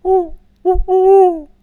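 high, loud imitated owl hoots answering back; reply like the second owl, loud and high. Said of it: "the other one would reply with".

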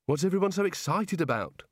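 understated desperate complaint. A narrator's reading. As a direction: plead innocence with a touch of desperation. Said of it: "said Rincewind desperately".

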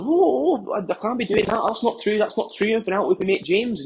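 high-pitched, flustered denial; squeaky mock-panicked protest; protesting innocence. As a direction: act out a caught husband, voice shooting up. Husband denies wife's accusation of cheating.

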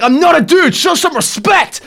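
high, loud mock indignation, slowing on respect; indignant yell. Offended man yelling at the driver for calling him dude, indignant. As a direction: demand respect in a shrill huff.

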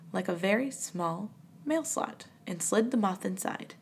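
plain reading voice

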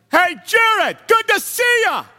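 loud, high greeting over headphones; too loud. Retold or imitated. Gym friend with headphones greeting Jared, far too loud. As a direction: call out a hello far too loud, as if music fills your ears. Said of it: "don't recognize the level and the sound of their voice".

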